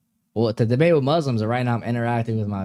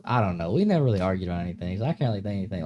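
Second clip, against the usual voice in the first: normal talking voice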